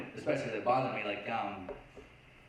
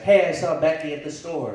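louder, light mock wife voice; wife's voice; 2 semitones higher, 9 dB louder than usual. Wife home from the store, a louder light mock voice. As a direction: lightly play the wife chatting. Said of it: "she's like".

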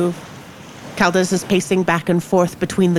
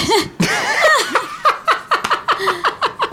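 high laugh. Real, not acted. Laughs at own joke.

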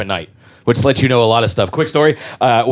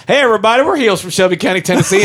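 put-on Southern accent; 8 semitones higher, faster than usual. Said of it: "we have accents".

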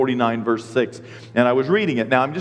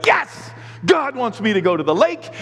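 higher, gleeful mock celebration; mock triumph; 6 semitones higher than usual. Lucky dipper reads his wished-for answer, mock triumph. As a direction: exult as if handed permission. Acting the dipper's joy at verse.

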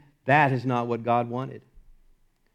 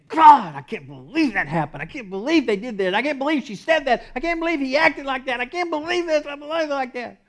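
rising exasperated complainer, higher and higher; frustrated complaint; 11 semitones higher, 4 dB louder than usual. Retold or imitated. Preacher voicing a churchgoer who can't believe it, exasperated. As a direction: voice the churchgoer's mounting disbelief.

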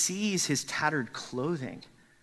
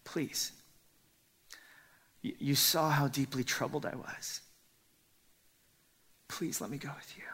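softer whispered pleading; whispered begging; 6 dB quieter than usual. Acted. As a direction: beg quietly and urgently. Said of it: "And he's like".

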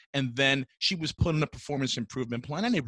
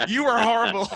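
laughing it out loud and higher; 9 semitones higher, 4 dB louder than usual. Real, not acted. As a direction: blurt it through real laughter. Teasing friend's terrible accent.

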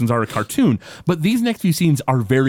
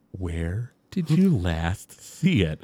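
very slow, low and softer; slow, careful low-voiced question; 8 semitones lower, 5 dB quieter, much slower than usual. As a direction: draw out each word of the question. Acted slow, careful questioning of Bart.

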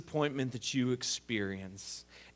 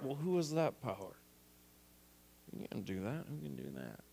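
much softer, mumbled under the breath; muttered aside; 10 dB quieter than usual. Retold or imitated. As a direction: grumble to yourself so nobody hears. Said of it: "trying to be quiet about what you're saying".

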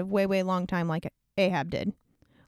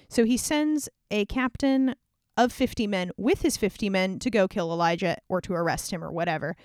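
normal talking voice

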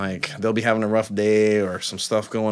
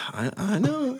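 sheepish; 13 semitones higher, 5 dB quieter, slower than usual. Retold or imitated. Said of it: "I was like".

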